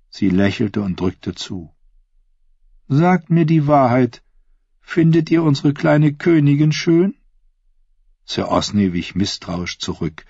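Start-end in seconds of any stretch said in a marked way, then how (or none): 2.9-7.1 probing queen. Acted. Queen probes the knight.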